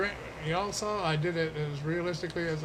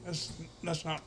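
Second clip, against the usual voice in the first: choking on a bug; coughing; 3 dB quieter than usual. Real, not acted. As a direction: cough through the words. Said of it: "Swallowed a bug".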